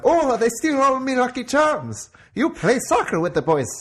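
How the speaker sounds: high, loud Irish leprechaun voice. Retold or imitated. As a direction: ham up a squeaky Irish leprechaun. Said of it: "you've got the Leprechaun like".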